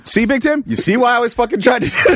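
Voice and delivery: high, fast told-you-so to the bouncer; high vindicated retort. Retold or imitated. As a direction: rub it in, high and quick. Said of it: "And so I go".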